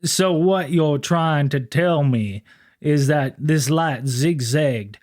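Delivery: slow, smooth, polite lawman voice; a courteous officer carefully summing up; mock-gentlemanly cop, slow; slow formal officer. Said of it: "Sisko was actually a Southern law enforcement officer".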